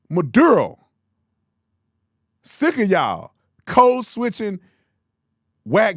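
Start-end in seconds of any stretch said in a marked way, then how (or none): 0.1-0.8 loud "Maduro", hard D, unrolled R; plain English pronunciation. Shown as a demonstration. Host giving the correction, emphatic English "Maduro". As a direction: hit the DUR in "Maduro", English sounds only. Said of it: "You're speaking English".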